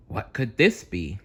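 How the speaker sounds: plain reading voice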